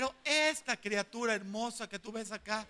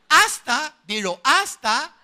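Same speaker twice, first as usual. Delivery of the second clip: loud urging; 6 semitones higher, 15 dB louder, slower than usual. Real, not acted. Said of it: "urging the congregation to answer".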